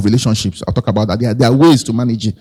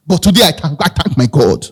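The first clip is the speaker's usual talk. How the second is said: ordinary delivery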